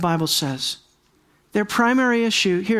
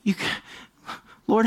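ordinary delivery

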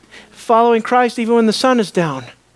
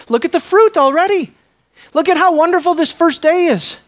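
excited Peter; 9 semitones higher, 4 dB louder than usual. Acted. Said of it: "in Peter's mind".

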